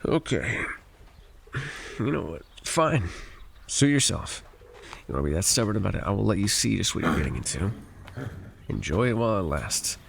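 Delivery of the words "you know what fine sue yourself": higher, exasperated give-in; exasperated snap. Acted. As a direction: toss it off, fed up. Guide gives up arguing.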